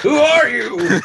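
put-on high, loud, quavering old man. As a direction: whine it like a confused grandpa. Mock grandpa bit.

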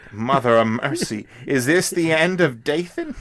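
hammed-up tough-guy voice; mock gangster lament. Host mocks Dathan's fate in a hammed-up gangster voice. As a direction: ham up a dying mobster's lament. Quoting a classic gangster line.

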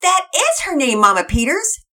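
put-on high, loud excited girl; a little girl bursting to tell; excited yell. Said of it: "Penelope yelled excitedly".

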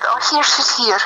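high-pitched, lilting Scottish welcome; Scottish greeting. Retold or imitated. Imitating a relative's fond greeting, high Scottish lilt. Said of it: "It's so Scottish".

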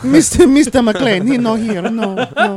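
high, loud mock maid accent; slow, hammed-up foreign housekeeper accent; Consuelo. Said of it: "That was a great impression".

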